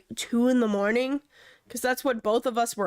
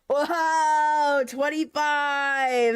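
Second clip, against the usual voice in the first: drawn-out cheer; excited; 4 semitones higher, much slower than usual. Real, not acted. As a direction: stretch it out, delighted. Excited reaction to joke.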